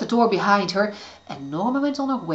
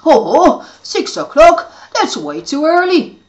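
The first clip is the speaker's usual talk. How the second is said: mumbling; 8 semitones higher, 9 dB louder, slower than usual. Acted. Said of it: "mumbled Neston".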